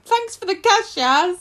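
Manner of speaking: high, loud, gleeful mock thanks; loud, chirpy send-up with a laugh behind it; mocking Sally impression. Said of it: "Sally's like".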